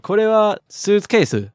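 これはスーツケース starts high and falls to the bottom; intonation left down. Shown as a demonstration. Teacher recalls his unanswered questions, スーツケース left falling at the end. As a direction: let the end of スーツケース drop like a statement. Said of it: "leave the intonation down at the bottom".

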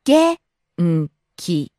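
元気 split into 元 and 気, pitch dropping on 気 and staying low; syllable by syllable. Shown as a demonstration. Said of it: "Falling on the second syllable".

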